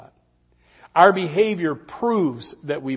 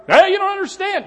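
mock protest; 8 dB louder than usual. Congregant arguing with preacher.

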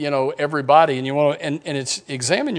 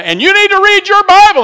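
mock preaching shout; 12 dB louder than usual.

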